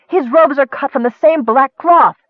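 high-pitched, insistent outburst; urgent cry. A narrator's reading. Nicole warning Michael about the wizard, crying out. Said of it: "Nicole cried".

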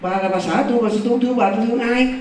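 retold protest climbing high in pitch; exasperated husband. Husband insisting she is his wife, mildly exasperated.

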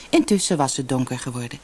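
usual speaking voice

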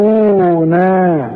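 انظرونا with the waw and alif held two counts; natural madd. Shown as a demonstration. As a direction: stretch the u and a in انظرونا. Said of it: "الراء مضمومة". (مد الواو والألف في انظرونا)

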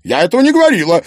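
fast, high, loud alarmed denial; frightened protest. A narrator's reading. Maryana backs away from ghost talk, frightened protest. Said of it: "испугалась Марьяна".

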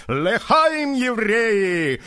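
high festive cry; shouted toast. Acted. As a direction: cry out a toast while dancing. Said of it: "крикнул пожилой еврей". (выкрик тоста)

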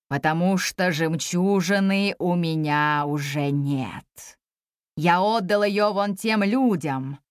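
very low, slow dragon voice; ponderous dragon. Acted. Dragon answering the girl's request, ponderous. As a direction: draw the words out low, like a puzzled old beast.

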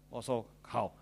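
ordinary delivery

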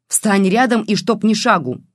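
loud, high angry order; furious threat. A narrator's reading. Grandmother threatening the girl with a stick, furious. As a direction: bark the order, draw out the threat. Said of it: "Снова рассвирепела старуха".

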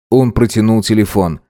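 ordinary delivery

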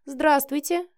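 polite, lifted-pitch hello; polite greeting. A narrator's reading. Young wife meeting her mother-in-law, politely. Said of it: "вежливо поздоровалась она".

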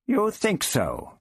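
high, slow, icy disdain; icy sniff. A narrator's reading. The stiffened Doctor answers Prapillus's request, icily. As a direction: draw it out as a disdainful challenge. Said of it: "he sniffed icily".

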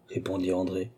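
ordinary delivery, nothing marked

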